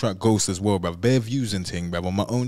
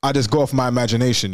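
ordinary talk, nothing marked

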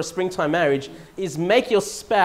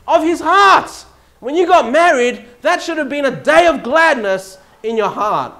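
high, loud, driving sermon delivery; full-force preaching; 7 semitones higher, 8 dB louder than usual. Acted. Preacher urging husbands to honour their marriage, full-force preaching. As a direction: preach it out to the congregation.

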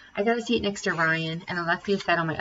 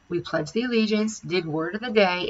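usual speaking voice

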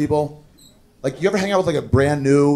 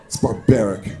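mock disgust; 9 semitones lower, 7 dB quieter than usual.

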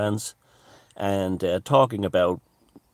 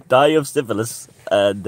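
laughing; 6 semitones higher, 7 dB louder than usual. Real, not acted. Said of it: "laughing repeat".

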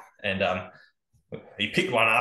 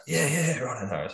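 higher-pitched mock brush-off; dismissive; 5 semitones higher than usual. Hunter told to bring arrows, retold as dismissive. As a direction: imitate a mate waving off advice. Friend brushes off repeated advice.

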